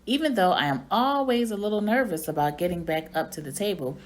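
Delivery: ordinary delivery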